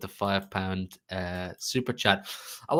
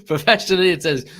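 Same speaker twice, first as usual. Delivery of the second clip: louder, higher announcer call; loud announcement; 7 semitones higher, 7 dB louder than usual. Real, not acted. Host announces a viewer's super chat, loud and high. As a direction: announce the donor's name loudly.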